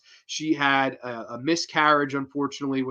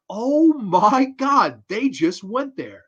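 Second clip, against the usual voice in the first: slow stunned disbelief; 6 semitones higher, 4 dB louder, slower than usual. Retold or imitated. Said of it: "being like".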